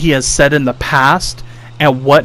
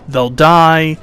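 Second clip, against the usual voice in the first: normal talking voice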